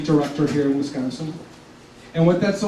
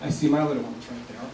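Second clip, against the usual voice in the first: real tears held back, much softer; choked up; 8 dB quieter than usual. Real, not acted. Speaking of his late dad to the audience, choked up and quiet. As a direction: voice catching as he talks about his late father. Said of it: "I get choked up".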